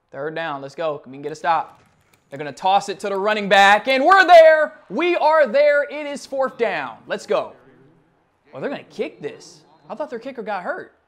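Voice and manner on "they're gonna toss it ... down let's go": loud, high play call; excited call. Real, not acted. Gamer calling his defensive stop, loud and high. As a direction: shout the stop as it happens.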